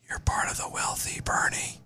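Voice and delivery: close-mic whisper; right in your ear. Acted. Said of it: "Getting close to the mic and whispering".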